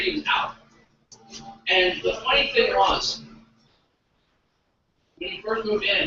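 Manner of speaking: ordinary talk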